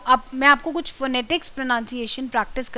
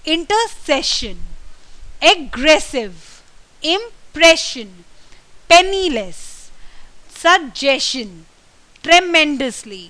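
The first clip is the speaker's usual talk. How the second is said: each word slow and louder, stressed syllable pushed up and held; word stress drill; 2 semitones higher, 3 dB louder, much slower than usual. Shown as a demonstration. Teacher drilling word stress for learners, slow and emphatic. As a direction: drill the words one by one, punching the stress. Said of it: "किस तरीके से हम कहाँ कहाँ पे जोर देते हैं".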